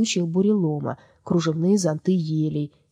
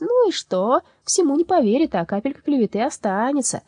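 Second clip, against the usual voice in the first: high, loud scornful retort; smirking; 9 semitones higher, 4 dB louder than usual. Acted. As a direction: sneer it off. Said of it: "Чёрная душа усмехнулась".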